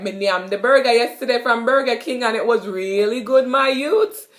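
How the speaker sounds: lively Jamaican patois, "my youth" rising. Shown as a demonstration. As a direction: brag in patois to a friend. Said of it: "you could be like".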